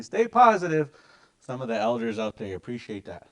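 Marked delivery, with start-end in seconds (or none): 0.0-0.9 higher, louder mock elder; elder urging. Elder urging the teacher on, louder mock voice. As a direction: call it out encouragingly. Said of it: "telling me".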